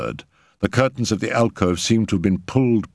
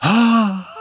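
high emotional gasping cry; 13 semitones higher, 4 dB louder than usual. A narrator's reading. Mother cries out at her child's call, high gasp. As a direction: cry out on a lifted, breath-filled "ah". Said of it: "cried Madame X".

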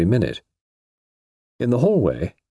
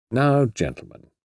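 mildly brisk, higher-pitched call to order; 5 semitones higher, slower than usual. A narrator's reading. Suspect addressing the two detectives, mildly brisk. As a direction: open the business with a touch of briskness. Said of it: "said Mister Eastwood briskly".

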